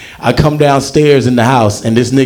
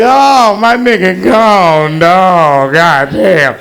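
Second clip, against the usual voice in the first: mock grieving wail; 4 semitones higher, much slower than usual. Acted grief at cat's death.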